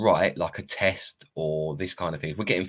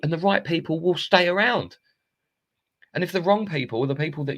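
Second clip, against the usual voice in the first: realisation; 7 semitones higher than usual. Retold or imitated.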